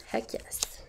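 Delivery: whispered little cheer; hushed cheer. Pretend. Crafter answering their own call for a heck yes, whispered. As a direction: answer yourself in a soft whisper.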